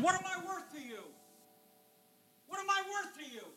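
very soft, high-pitched plea; hushed question. Acted. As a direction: drop suddenly to a tiny, hushed high voice. God's wounded question, voiced hushed.

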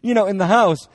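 high, loud nagging wife with a laugh; exasperated wife. Retold or imitated. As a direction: play the fed-up wife scolding her philosopher husband. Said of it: "And she's like".

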